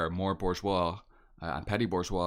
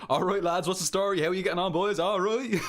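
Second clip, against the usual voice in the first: mock Irish lads' greeting, much higher and louder; hammed-up greeting to the boys, pitched way up; 11 semitones higher, 5 dB louder than usual. Mocking how they'd greet the lads, high and loud. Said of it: "that's what we'd be like".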